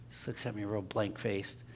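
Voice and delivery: ordinary delivery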